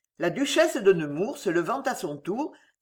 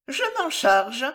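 high-pitched, smiling volunteer; peculiar smile; 5 semitones higher than usual. A narrator's reading. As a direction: take it on with an odd smile in the voice. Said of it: "avec un singulier sourire". (sourire singulier, ton haut perché)

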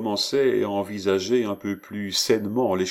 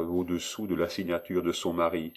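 usual speaking voice

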